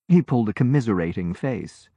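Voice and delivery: usual speaking voice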